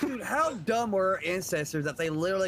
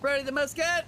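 raised-pitch pretend officer's call; hammed-up military command; mock drill command; 5 semitones higher than usual. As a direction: call out a mock musket order.

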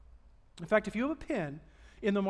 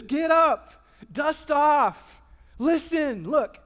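angel's command; 5 semitones higher, 8 dB louder, much slower than usual. Pretend. Angel voiced calming shepherds.

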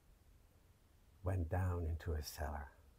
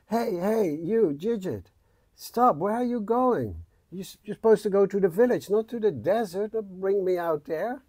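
alarmed shout; 12 semitones higher, 12 dB louder than usual. Acted. Jidget shouting at runaway horse.

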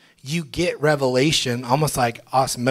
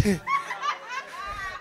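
high breathy laugh; real joyous giggle; giggling; 8 dB quieter than usual. Real, not acted. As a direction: dissolve into giggles. Woman laughing in joy.

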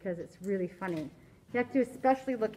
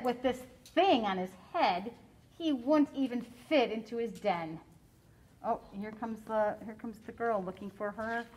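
usual speaking voice

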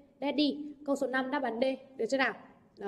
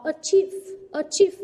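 "achieve" with a high, loud CHIEVE; second-syllable stress; 4 semitones higher, 6 dB louder, slower than usual. Shown as a demonstration. Teacher modelling word stress for students, "achieve" punched on CHIEVE. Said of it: "trọng âm rơi vào âm tiết thứ hai".

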